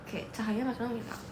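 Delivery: ordinary talk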